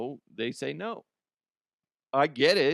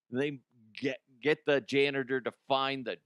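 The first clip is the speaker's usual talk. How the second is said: ordinary delivery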